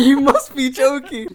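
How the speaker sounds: high, loud, drawn-out incredulity; hammed-up high-pitched protest; mock disbelief. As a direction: exclaim it slowly, as if outraged. Retelling own incredulous reply.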